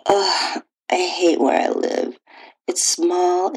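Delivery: ordinary delivery, nothing marked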